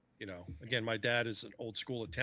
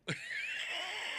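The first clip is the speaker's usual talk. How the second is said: high laugh. Real, not acted. Laughing at dad's reaction.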